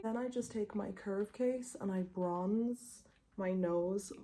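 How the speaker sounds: usual speaking voice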